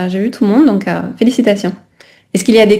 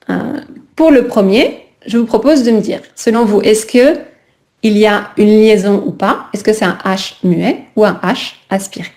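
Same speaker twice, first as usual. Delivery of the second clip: ordinary talk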